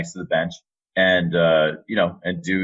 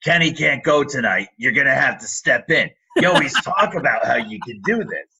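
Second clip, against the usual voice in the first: higher, imitating a thick Staten Island accent; 6 semitones higher than usual. Supervisor telling him to step in, imitated thick Staten Island accent. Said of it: "in her thick Staten Island accent".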